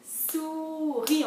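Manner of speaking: "souris" in two clapped beats, high and loud; "souris" split loudly for counting; syllable-by-syllable. Shown as a demonstration. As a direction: stretch "souris" as sou, then ri. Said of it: "On a tapé combien de fois?".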